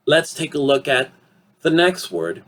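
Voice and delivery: normal talking voice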